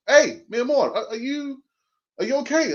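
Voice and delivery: lightly voiced worried wife; imitating wife. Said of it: "So my wife will say to me".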